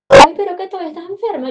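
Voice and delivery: high, loud, imitating a surprised friend; hammed-up outraged protest; mock indignant cry. Friend replying to a sick Nico, imitated loud and high.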